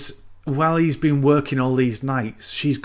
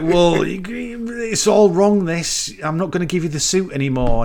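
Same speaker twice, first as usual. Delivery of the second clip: horrified refusal; 6 semitones higher, 3 dB louder than usual. Retold or imitated. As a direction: drag out the refusal in a high, shocked voice. Tailor refuses after seeing corpse.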